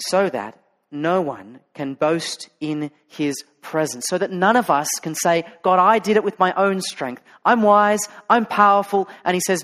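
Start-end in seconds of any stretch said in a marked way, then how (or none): 5.6-9.1 boasting. Retold or imitated. Boastful voice of the proud.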